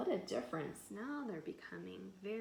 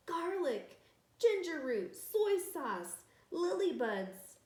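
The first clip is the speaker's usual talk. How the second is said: louder, higher calls of excited villagers; eager calling out; 8 semitones higher, 8 dB louder, slower than usual. Acted. Villagers offering food for the pot, eager calls. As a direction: shout out each ingredient gladly.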